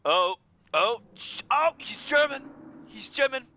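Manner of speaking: very high and loud; excited. Real, not acted. Streamer delighted by the German cat, high excited squeal.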